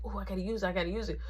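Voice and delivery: hushed, urgent whisper. Retold or imitated. Whispering to himself about needing the bathroom, urgent hush. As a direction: whisper it like you really have to go.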